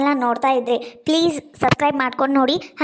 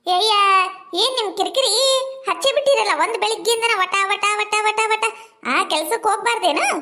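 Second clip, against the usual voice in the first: exasperated nagging; 4 semitones higher than usual. Acted. Wife fed up with husband's pestering.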